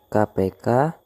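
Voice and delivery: normal talking voice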